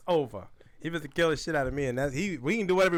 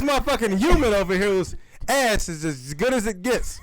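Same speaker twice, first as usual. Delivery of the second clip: mock lion calling buddies; 6 semitones higher, 5 dB louder than usual. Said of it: "calls over his buddies like".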